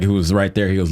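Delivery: usual speaking voice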